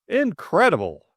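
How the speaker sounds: high, loud exclamation; flabbergasted. A narrator's reading. George taking in the wounded man, flabbergasted. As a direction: exclaim it in disbelief.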